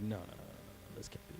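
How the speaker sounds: soft, breathy string of no's; hushed panic. Retold or imitated. Preacher voicing brothers in dread, a hushed panicked murmur.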